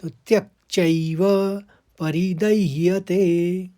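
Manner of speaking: चैव with an ai sound, परिदह्यते slow; careful pronunciation. Shown as a demonstration. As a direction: sound out each syllable of चैव. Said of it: "दो आई की मात्रा है तो चै". (चैव का सही उच्चारण)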